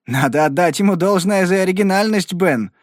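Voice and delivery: usual speaking voice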